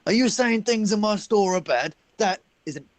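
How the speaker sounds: high-pitched, offended shopkeeper; indignant shopkeeper. Acted. Shopkeeper defending his father's store to a customer, indignant.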